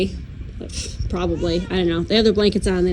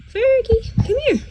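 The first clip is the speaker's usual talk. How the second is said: high sing-song call to the cat; higher pet call; 13 semitones higher than usual. Real, not acted. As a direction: coax the cat over in a high voice. Calling the cat, pitch jumps.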